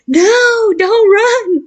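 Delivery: high, loud alarmed shout; screaming doctors. Retold or imitated. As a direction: imitate a crowd yelling a warning. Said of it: "a hundred doctors looking at me screaming".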